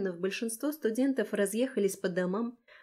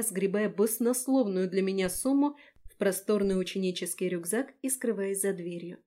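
plain reading voice

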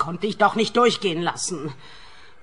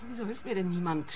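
ordinary delivery

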